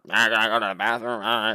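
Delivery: rushed, jeering mimicry; mocking imitation. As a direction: imitate someone to make fun of them. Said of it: "just making fun of me".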